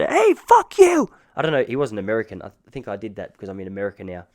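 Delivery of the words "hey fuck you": loud, high, drawn-out angry yell. Retold or imitated. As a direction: holler it back across the dark. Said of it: "He just yelled".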